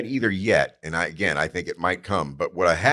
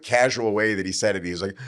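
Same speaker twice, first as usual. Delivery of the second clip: ordinary delivery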